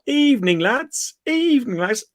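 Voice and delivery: imitating the steward's cheery farewell; mocking the steward's greeting. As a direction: lilt it like a smug steward waving fans off.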